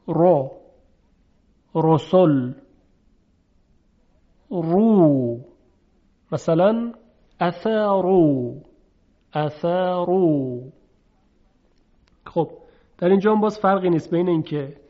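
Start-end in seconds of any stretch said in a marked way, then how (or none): 0.1-10.6 heavy ر with a u vowel in «رو»; thick, full r in «رسل» and «اثرو»; تفخیم. Shown as a demonstration. («رو، رسل، اثرو» با رای درشت)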